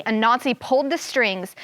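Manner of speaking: usual speaking voice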